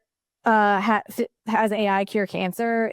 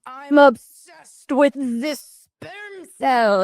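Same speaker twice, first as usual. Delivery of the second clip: slow, mock German accent; 4 semitones higher, much slower than usual. Joking about sperm cells to co-host, in a mock German accent. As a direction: drag it out in a hammed-up German accent. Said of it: "like, a German accent".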